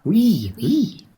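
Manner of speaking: high, eager yes yes; pressing her to tell. Acted. Travellers pressing the girl to tell, eager.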